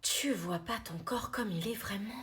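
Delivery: soft, slow whisper. Acted. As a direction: breathe it gently. Gentle question to upset friend.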